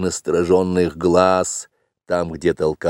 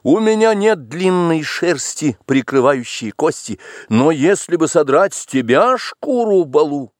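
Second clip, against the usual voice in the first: put-on indignant boy; 8 semitones higher than usual. Angry boy retorts.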